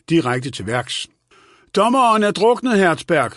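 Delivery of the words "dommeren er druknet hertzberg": high, raised-voice call; råb. A narrator's reading. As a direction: call out the news bluntly and loudly. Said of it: "råbte hun". (råbende, højt toneleje)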